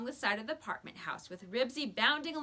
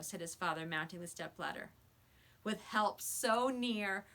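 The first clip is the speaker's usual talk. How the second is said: ordinary delivery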